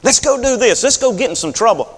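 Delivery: tempting a friend along; kid egging on. Retold or imitated. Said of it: "That sounds like trouble".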